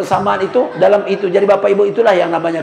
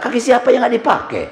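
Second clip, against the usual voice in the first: ordinary delivery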